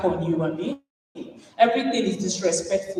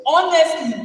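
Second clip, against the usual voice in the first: usual speaking voice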